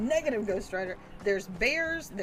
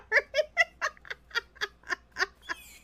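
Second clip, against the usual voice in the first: a long high burst of laughter; high cackling laugh; 13 semitones higher, 5 dB louder than usual. Real, not acted. Gamer cracking up after accidentally killing someone, high laughter. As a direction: crack up at your own blunder.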